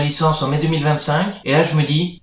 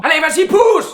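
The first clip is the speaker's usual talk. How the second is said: high, loud coaching shout; cheering push; 13 semitones higher, 5 dB louder than usual. Acted. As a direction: yell encouragement at a birth. Cheering at birth.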